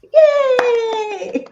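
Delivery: high, long drawn-out yay; stretched, high-pitched hooray; celebratory cheer. Real, not acted. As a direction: cheer for a friend's small win. Cheers as co-host gets it working.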